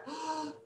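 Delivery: big breath in. Shown as a demonstration. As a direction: suck in a big breath before a race. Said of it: "have to take a big breath".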